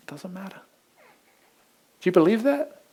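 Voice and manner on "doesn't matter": much softer, gentle brush-off; soft, reassuring answer; quiet dismissal. Acted. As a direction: let it fall away softly. Pastor's answer to each objection.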